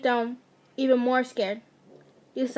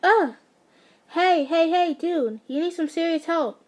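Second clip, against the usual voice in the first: scolding; 4 semitones higher than usual. A narrator's reading. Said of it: "he scolded".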